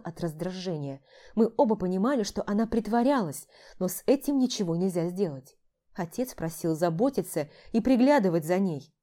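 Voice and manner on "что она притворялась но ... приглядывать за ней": ordinary delivery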